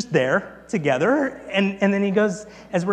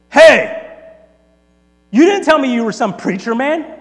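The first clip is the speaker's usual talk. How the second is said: loud, high, startled challenge; accusing challenge; 8 semitones higher, 12 dB louder than usual. Retold or imitated. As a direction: call him out loudly, taken aback. Cook challenges Tony loudly.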